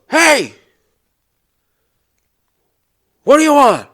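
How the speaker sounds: hollered, high and very loud; Fred's gruff challenge. Retold or imitated. Fred confronts stranger from his porch, shouting challenge. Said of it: "He goes".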